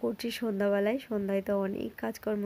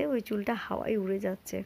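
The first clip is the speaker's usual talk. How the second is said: ordinary delivery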